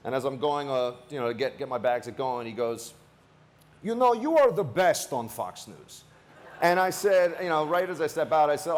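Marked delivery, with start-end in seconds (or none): 3.8-6.0 put-on slow, warm Slavic-accented compliment. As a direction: lean into the Slavic accent, drawing out each word. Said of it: "He's got kind of a Slavic accent".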